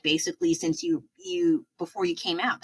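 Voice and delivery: usual speaking voice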